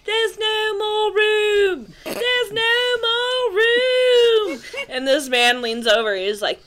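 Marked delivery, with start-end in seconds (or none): 0.0-4.6 put-on high, slow, drawn-out child's yell; yelling little girl. Friend imitates little girl on packed tube, high drawn-out yell. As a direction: wail each word out long and high, like a small kid. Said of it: "She kept yelling".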